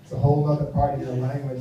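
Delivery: normal talking voice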